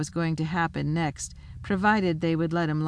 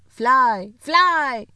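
much higher, louder frantic cry; panicked shout; 8 semitones higher, 9 dB louder than usual. A narrator's reading. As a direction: scream at them to run.

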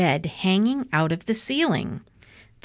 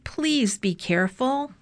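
higher, mild plea; lightly voiced anxious caution; worried sister; 6 semitones higher than usual. A narrator's reading. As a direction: gently urge care, pitch raised. Baby pleads for care.

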